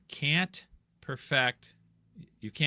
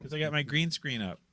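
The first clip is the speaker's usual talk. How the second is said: higher-pitched pet talk; talking to the dog; 4 semitones higher, 3 dB quieter than usual. Real, not acted. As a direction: tease a pet in a raised, playful pitch.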